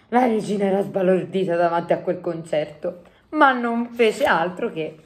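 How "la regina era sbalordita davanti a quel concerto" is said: ordinary delivery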